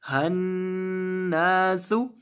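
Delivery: हन्नासु with the doubled nun hummed through the nose; slow, humming the न of हन्नासु; nasal ghunna. Shown as a demonstration. Teacher showing ghunna on the doubled nun, slow nasal hum. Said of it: "नून के ऊपर गुन्ना करेंगे".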